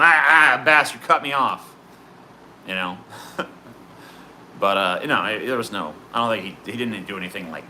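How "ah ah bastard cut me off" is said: louder mock-pissed outburst; mock angry. Driver cutting him off, retold louder mock anger. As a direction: vent like someone who just got cut off. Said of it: "I was pissed for a second".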